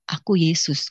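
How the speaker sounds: low, calm announcement; Yesus. Retold or imitated. The voice reveals itself to her, low and calm.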